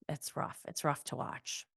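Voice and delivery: soft, sighed and whispered; sighing. Real, not acted. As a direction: breathe it out heavily. Sighing over Monica's demeanor.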